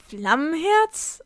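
slow, high, rising question; startled interruption. A narrator's reading. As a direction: cut in, stretching the name upward in surprise. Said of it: "unterbrach Sam den weißen Wolf".

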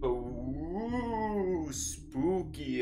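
drawn-out ghostly wail; mock spooky. Player mocking the spooky nightmare menu, mock spooky. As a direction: draw it out in a playful spooky sing-song.

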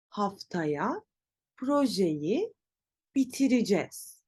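bitireceğiz said bi-ti-ri-jez, phrase in separate chunks; spoken contraction. Shown as a demonstration. Teacher repeats for learners, bitireceğiz contracted to bitiricez. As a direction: pause between words, shorten bitireceğiz to bitiricez. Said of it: "Nasıl telaffuz edeceğiz?".

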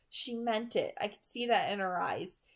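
usual speaking voice

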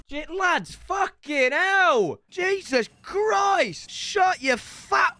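drawn-out high exasperated yell; angry shout. Real, not acted. Gamer to noisy builders over the racket, angry shout. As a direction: yell each word out, high and stretched.

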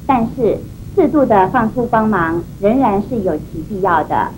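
ordinary talk

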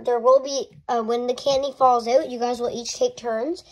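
usual speaking voice

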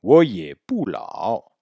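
我也不老 syllable by syllable, full tones; drawn-out Mandarin drill; slow repeat model. Shown as a demonstration. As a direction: say it slowly for students to copy. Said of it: "Répétez".

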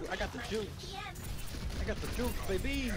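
high, hyped bragging; hyped boast. Real, not acted. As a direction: brag it like you're on fire. Hyped about own ultimate.

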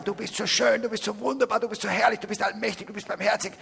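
high, sing-song flattery, piling on adjectives; gushing praise. Retold or imitated. Preacher mocks flowery prayers to God, high and gushing. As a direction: lay it on thick like an overeager worshipper. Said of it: "du wirst auch nicht sagen".

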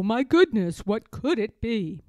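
ordinary delivery

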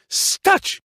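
sharp, high, loud order; commanding shout. A narrator's reading. Reksio halting the charging hens, shouting. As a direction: yell to stop the fight. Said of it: "próbował powstrzymać je Reksio".

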